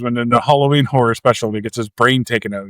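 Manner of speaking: normal talking voice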